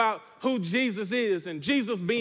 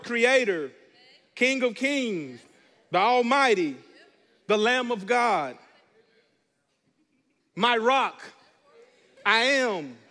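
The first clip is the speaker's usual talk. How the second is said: proclaiming names; slower than usual. Acted. Pastor calling out Jesus' names to his church, proclaiming. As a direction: lift up each name to the congregation.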